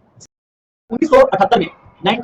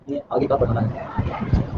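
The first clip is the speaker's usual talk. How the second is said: breathy unvoiced aside, very slow; teacher whispering an aside about what to read next; whispered aside; 10 semitones lower, 9 dB quieter, much slower than usual. Real, not acted. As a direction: whisper a note to yourself, slowly. Teacher aside while reading notes.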